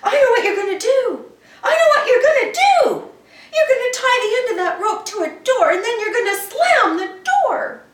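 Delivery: high, loud laughing singsong; laughing taunt. Acted. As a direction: giggle it out like a kid who knows the trick. Andrew guesses, laughing.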